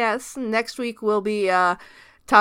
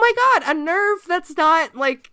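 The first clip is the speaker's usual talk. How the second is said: high, loud burst of delight; excited; 9 semitones higher, 5 dB louder than usual. Real, not acted. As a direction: blurt it out thrilled. Delighted at a positive nerve.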